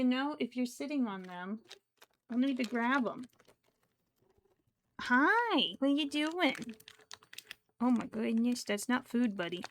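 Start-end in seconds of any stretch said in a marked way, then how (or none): 5.0-6.6 high, louder swooping greeting; playful high-pitched hello to a pet; pet talk. Real, not acted. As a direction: greet a cat in a high lilt. Greeting the meddling cat.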